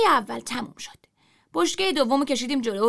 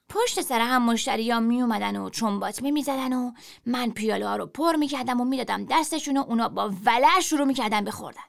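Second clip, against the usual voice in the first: ordinary delivery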